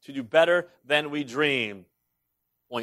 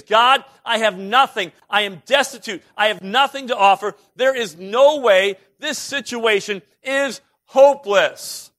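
high, loud, slowing cry of despair; desperate plea; 6 semitones higher, 5 dB louder than usual. Retold or imitated. Moses confessing to God, a loud desperate plea. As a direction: sound utterly helpless. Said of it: "What he was saying is".

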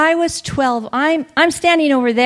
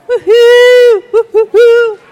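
high, loud woohoo; excited cheer; 9 semitones higher, 10 dB louder, slower than usual. Real, not acted. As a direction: cheer them on. Cheering on Puerto Ricans.

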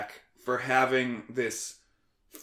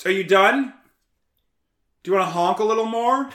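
loud, high, needling questions; sarcastic heckling; 8 semitones higher, 7 dB louder, faster than usual. Real, not acted. Guest calling out to whoever is honking, loud and sarcastic. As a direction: call out mockingly to the honker.

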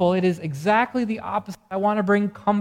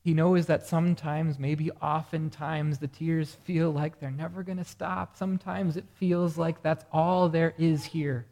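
tender and hushed; 3 semitones lower, 4 dB quieter than usual. Acted. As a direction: soothe the grieving like a gentle shepherd. Tender pastoral reflection.

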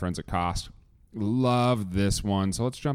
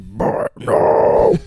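Buy it now as a breathy screamed metal growl, much louder and slow; metalcore scream; 11 dB louder, much slower than usual. Shown as a demonstration. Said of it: "a little bit of metalcore".

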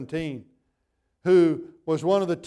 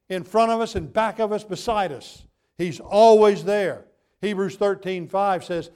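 louder, climbing preaching with punched nevers; fervent preaching; 3 semitones higher, 3 dB louder than usual. Acted. Pastor assuring the congregation Jesus never leaves, fervent preaching. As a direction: preach it with rising conviction.

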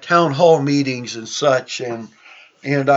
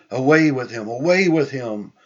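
usual speaking voice

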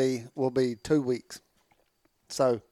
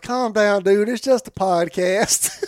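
amused calm down; 6 semitones higher, 5 dB louder than usual. Retold or imitated. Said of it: "I was like".

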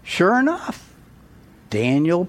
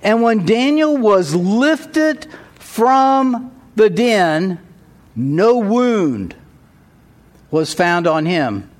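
scripture reading; 6 semitones higher, 3 dB louder than usual. Acted. Reads the rest of the story.

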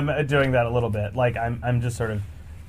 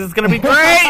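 hyped; 12 semitones higher, 9 dB louder than usual. Real, not acted. Hyping the next movie.

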